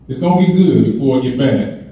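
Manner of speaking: ordinary delivery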